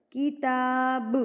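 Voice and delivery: کتاب with the final ب bounced and echoing; slow کتاب with a bouncing ب; qalqala bounce. Shown as a demonstration. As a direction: shake the ب of کتاب at the end. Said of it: "ہلا کے تھرتھراہٹ سے پڑھا جاتا ہے".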